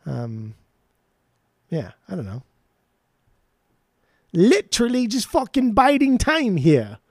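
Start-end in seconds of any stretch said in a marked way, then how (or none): 4.3-7.0 slow, high-pitched bad English accent; hammy, badly faked English accent. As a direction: drag it out in a poor English accent. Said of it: "my English accent. Dude, my, it's so bad".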